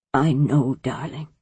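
faint, low, failing wheeze; fading breath on each word; wheezing. Acted. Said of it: "Zan wheezed".

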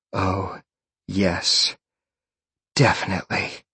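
mildly breathless, softly spoken; a touch of panting between words; panted. A narrator's reading. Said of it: "she panted".

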